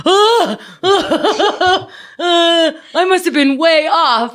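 high, laughing embarrassed "ah, ah"; flustered nervous laugh. Retold or imitated. Answering a friend's curt question, flustered laugh.

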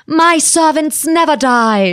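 put-on high, slow heroic cry in a Swiss accent; Mercy. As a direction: proclaim it like a game heroine's signature line. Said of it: "can I hear her voice live".

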